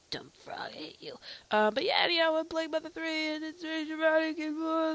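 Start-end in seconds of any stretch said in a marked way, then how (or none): 0.1-1.2 hushed muttered grumble at the frog; whispering. Real, not acted. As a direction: whisper it under your breath.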